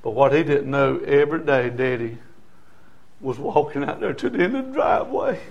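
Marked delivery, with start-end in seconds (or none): none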